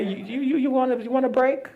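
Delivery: high, stammering mock concern; tentative offer. Offering the shadow a break, high and tentative.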